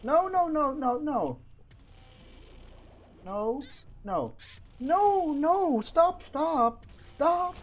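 high, frantic repeated refusals; rising panic, crying out; panicked pleading. Real, not acted. Begging attacking enemies to stop.

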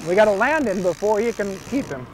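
ordinary delivery

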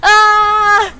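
high, loud panic screams; panicked screaming. Real, not acted. Panicked screams during a fight.

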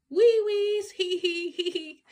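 squeaky high giggles. A narrator's reading. A little critter popping up, squeaky high giggling. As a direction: giggle like a tiny beach critter.

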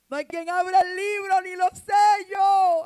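high, slow, loud wail; hammed-up shouted lament; mock despair. Voicing John's despair. (lamento gritado y exagerado)

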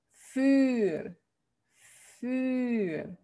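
für with long rounded "üü"; correct Dutch version. Shown as a demonstration. Teacher showing how "für" sounds, long rounded vowel. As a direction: hold "für" on a tight front rounded vowel. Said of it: "Cómo suena".

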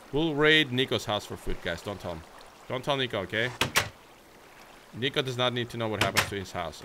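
usual speaking voice

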